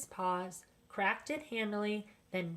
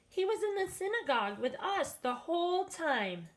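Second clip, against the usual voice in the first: neighbors; 8 semitones higher than usual. A narrator's reading. Neighbors insist.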